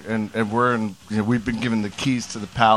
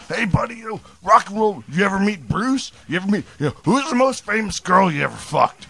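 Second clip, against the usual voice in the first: much higher, slurring mock drunk; drunk businessman; 8 semitones higher than usual. Drunk seatmate grabbing his shirt, much higher mock voice. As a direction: play the drunk seatmate pestering. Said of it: "He's like, you know".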